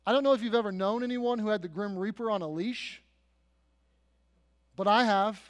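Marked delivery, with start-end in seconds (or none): none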